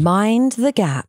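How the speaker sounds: slow British mind the gap announcement; London Underground announcer in a British accent; British accent, deliberate like a tube announcement. Shown as a demonstration. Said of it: "with the accent especially".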